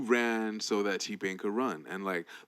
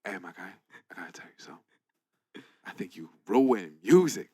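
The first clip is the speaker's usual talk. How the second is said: sexy voice; 3 semitones lower, 3 dB louder than usual. Retold or imitated. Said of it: "He probably said it with a sexy-ass voice".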